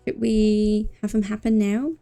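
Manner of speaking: usual speaking voice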